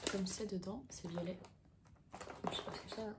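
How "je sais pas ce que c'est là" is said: whispered mutter. Real, not acted. Muttering to self.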